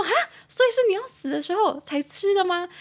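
high-pitched, mock-aghast question; hammed-up foreigner taken aback; shocked disbelief. Foreigner hears the name coffin bread, mock shock.

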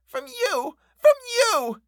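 put-on high child crying out, rising. A narrator's reading. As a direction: cry it out at her. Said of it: "she cried".

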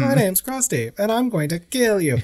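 squeaky toy clown threat; Krusty doll voice. Retold or imitated. Doing the Krusty doll threatening Homer in a high cartoon voice. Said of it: "it's, of course, Krusty".

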